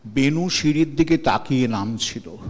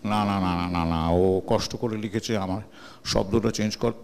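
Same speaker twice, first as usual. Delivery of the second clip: lower, soft imitation of Uttam Kumar; a gentle, lowered star's refusal; Uttam Kumar's manner; 8 semitones lower, 5 dB quieter than usual. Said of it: "ওনার স্বভাবসিদ্ধ স্টাইলে বলে". (উত্তম কুমারের ঢঙে নরম নিচু গলায়)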